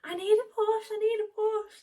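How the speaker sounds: high, loud, near-crying plea; desperate plea. Retold or imitated. Mother in labour begging the midwife, crying out. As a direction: beg through the pain with a crying edge. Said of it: "I was like".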